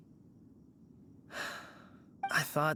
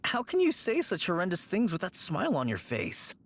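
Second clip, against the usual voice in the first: shocked protest; 8 semitones higher than usual. Acted. Shocked at Hu Tao's grim joke.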